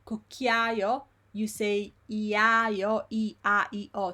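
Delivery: cucchiaio stretched slowly into iaio, I A I O; every vowel sounded. Shown as a demonstration. Teacher lets learners hear all the vowels, slow vowel demo. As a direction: draw out each vowel of iaio. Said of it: "So you hear all the vowels here".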